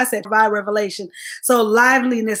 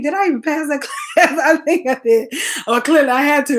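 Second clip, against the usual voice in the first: laughing; 3 semitones higher, faster than usual. Real, not acted. Laughing at failing class.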